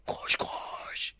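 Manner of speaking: forced whisper of run run; breathy urging. Real, not acted. Gamer urging his striker forward in a strained whisper. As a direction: push him on in a strained whisper.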